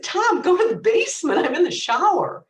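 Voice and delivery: high, loud order through the door; retold yell at a pestering brother; exasperated shout. Sister in the shower sending brother downstairs, exasperated shout.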